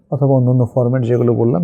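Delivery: ordinary talk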